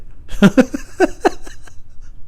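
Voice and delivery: high, breathy stuttering giggle; real high wheezy laughing fit; helpless high-pitched cackle; odd laugh. Real, not acted. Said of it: "Why do I laugh like that also?".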